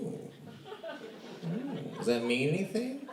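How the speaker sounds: teasing, sing-song mock interest; mock impressed. Strangers cooing over his name, mock fascinated.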